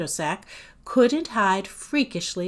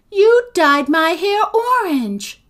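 loud, high-pitched grievance; indignant accusation; 10 semitones higher, 10 dB louder than usual. A narrator's reading. Agatha accuses Sophie through the door, loud and indignant. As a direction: fling the complaint out indignantly.

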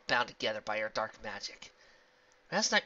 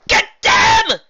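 very high, very loud shriek of command; ranting order; 22 dB louder than usual. Acted. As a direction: scream the attack order. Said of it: "ranted the evil princess".

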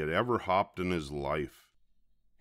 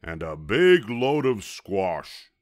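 loud, slow, drawn-out order; barking orders; 7 dB louder than usual. A narrator's reading. Varmint demanding more ingredients, barking orders. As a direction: bark it out, leaning on each big word. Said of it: "barking orders".

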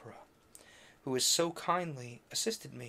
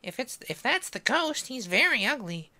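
put-on high, scared little girl; girl's voice; 11 semitones higher, 4 dB louder than usual. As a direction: squeak it like a frightened girl. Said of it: "Little Giry added".

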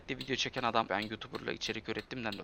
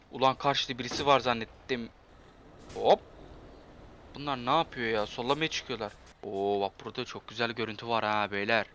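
usual speaking voice

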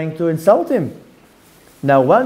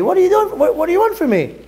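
high-pitched, acted exasperation; exasperated protest; 10 semitones higher, faster than usual. Levi answering Shimon's insults, high exasperated protest. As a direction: plead your innocence in a high mock wail.

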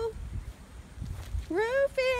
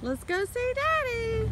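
ordinary talk

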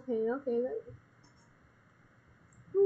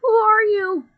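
mock shocked shout; 8 semitones higher, 10 dB louder than usual. Acted villain confrontation.

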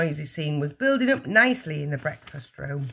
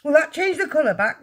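high-pitched worried question; anxious; 11 semitones higher, 5 dB louder than usual. A narrator's reading. Father hopes washing will help, anxious. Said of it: "the father asked anxiously".

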